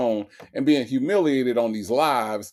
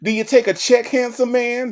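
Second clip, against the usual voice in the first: flirty mock Sonya; 10 semitones higher than usual. Host imitating Sonya teasing the scammer, high and flirty. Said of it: "Major's wife says".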